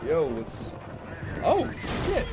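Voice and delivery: sudden cry, water down the wrong pipe; coughing up water. Real, not acted. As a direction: yelp while choking on water. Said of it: "water that went down the wrong way".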